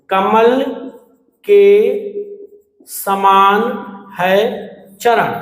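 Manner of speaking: कमल के समान है चरण said slowly with pauses between words; stretched apart word by word. Shown as a demonstration. Teacher to students, breaking the compound apart: slow, paused words. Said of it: "शब्द को थोड़ा सा फैलाएंगे".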